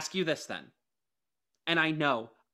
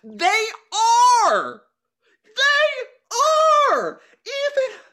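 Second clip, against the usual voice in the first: high, loud insistent shout; real shout; 10 dB louder, slower than usual. Real, not acted. Host shouting back at her co-host that they are working hard. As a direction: yell it to win the argument.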